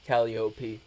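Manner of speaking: Calliope as KAL-ee-OH-pee; alternative Calliope pronunciation. Shown as a demonstration. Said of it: "it pronounced as Calliope or".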